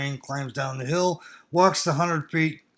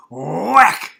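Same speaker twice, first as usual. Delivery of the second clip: sharp whack sound; 11 dB louder than usual. Retold or imitated. Father slapping him, imitated hit.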